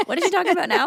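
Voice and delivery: high, loud mock bewilderment; dismayed listener. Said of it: "I was like "Oh dear".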